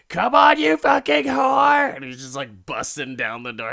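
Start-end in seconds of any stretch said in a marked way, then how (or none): 0.1-1.9 much higher, much louder screaming rage; furious scream. Retold or imitated. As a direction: explode at her through the door. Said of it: "He's like".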